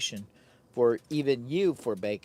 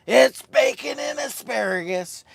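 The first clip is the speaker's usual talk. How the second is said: louder and much higher, announcing "asparagus"; hyped announcement; 9 semitones higher, 7 dB louder than usual. Shown as a demonstration. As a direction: proclaim the dish like a showman.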